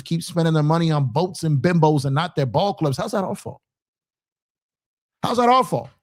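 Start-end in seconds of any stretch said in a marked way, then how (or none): 5.2-5.9 rising outrage on the repeat. Real, not acted. Repeats question, then louder.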